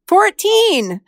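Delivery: Fourteen said high and punched, falling off; emphatic fourteen. Real, not acted. Therapist marveling at the part's age, Fourteen stressed high. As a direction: lean hard on Fourteen in disbelief.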